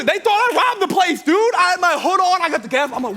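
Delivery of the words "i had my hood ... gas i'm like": ordinary talk, nothing marked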